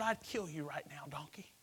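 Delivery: hushed whispered threat; menacing whisper. Retold or imitated. Balaam threatening his donkey in a whisper. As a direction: hiss the threat low and soft.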